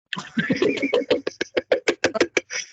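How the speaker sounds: high loud cackle; loud burst of laughter. Real, not acted. Laughing at annoying followers.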